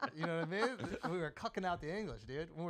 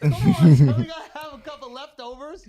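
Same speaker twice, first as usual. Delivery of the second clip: loud, higher voice, through laughter; laughing loudly; 7 semitones higher, 16 dB louder than usual. Real, not acted. Laughing at own roast jokes.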